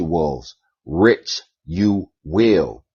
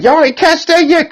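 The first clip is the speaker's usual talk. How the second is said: much higher, louder disbelief; incredulous; 5 dB louder than usual. Retold or imitated. Sitting there watching them, high incredulous squeak. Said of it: "I'm just sitting there like".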